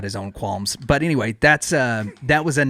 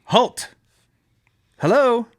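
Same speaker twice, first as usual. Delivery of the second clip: King Arthur calling out; 11 semitones higher, slower than usual. Acted. Arthur hails the castle guard, raised royal call. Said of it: "I will be Arthur".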